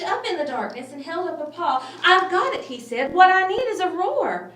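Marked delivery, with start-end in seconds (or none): 2.0-2.6 high, loud burst of excitement; excited squeak. Acted. Mouse in bed struck by an idea, shouting excitedly. 3.1-4.5 high, loud burst of excitement; excited squeak. Acted. Mouse in bed struck by an idea, shouting excitedly. Mouse announcing his plan.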